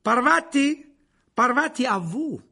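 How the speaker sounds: loud, high call to a pupil; appel du professeur. A narrator's reading. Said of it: "le professeur Lupin appela alors". (appel fort et aigu)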